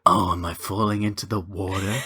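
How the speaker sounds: low, mock-oblivious voice; put-on film character. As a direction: drop the pitch for a hammed-up character. Playing a film character falling in.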